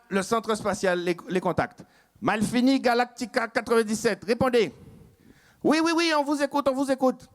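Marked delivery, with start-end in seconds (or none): 2.2-4.7 appel radio. Acted. Centre spatial appelle par radio. 5.6-7.3 vite, aigu, faussement tranquille; réponse précipitée. Acted. Équipage répond au centre.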